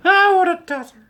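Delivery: high, loud exasperated cry at himself; mock-outraged at his own mistake; self-mocking squeal. Real, not acted. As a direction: scold yourself in a shrieky voice. Mocking himself after forgetting camera.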